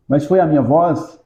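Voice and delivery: usual speaking voice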